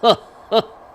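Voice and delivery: loud, high sardonic cackle; mocking ghostly laugh. A narrator's reading. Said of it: "Homer laughs sardonically".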